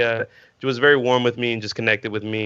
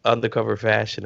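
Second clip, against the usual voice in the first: normal talking voice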